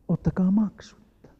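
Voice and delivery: hushed whisper of the free offer. Acted. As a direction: breathe it out, no voice. Quiet repeat after reading.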